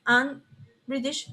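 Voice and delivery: ordinary delivery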